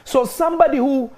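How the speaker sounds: high, loud, rising sermon; fiery preaching. Acted. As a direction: preach it with mounting fire. Preaching builds to full force.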